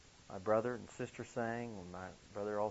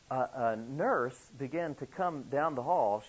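ordinary talk

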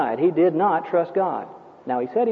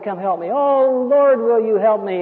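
raised, pleading cry to God; frightened man praying for rescue, pitched up; earnest plea; 4 semitones higher, 3 dB louder, slower than usual. Retold or imitated. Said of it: "he prayed".

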